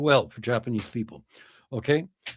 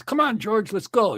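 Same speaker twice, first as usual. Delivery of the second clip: golfer calling George; 9 semitones higher than usual. Acted. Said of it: "he says".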